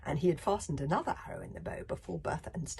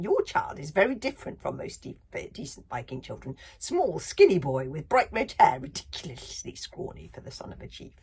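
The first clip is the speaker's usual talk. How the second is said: sneering librarian; 8 dB louder than usual. Acted. Librarian figure continues.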